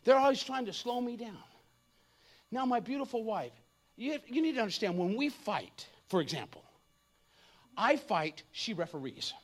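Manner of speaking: ordinary talk, nothing marked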